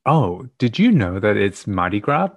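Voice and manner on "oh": usual speaking voice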